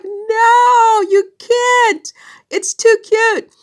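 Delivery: very high, loud squeal of adoration; gushing protest. Retold or imitated. Teacher begging student to keep his accent, gushing.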